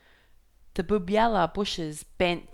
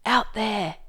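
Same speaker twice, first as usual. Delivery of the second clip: high, loud yell; 4 semitones higher, 7 dB louder than usual. Acted. Hideaway points Stormboy at the wreck, loud high yell. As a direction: shout it into the roaring wind. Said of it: "he yelled".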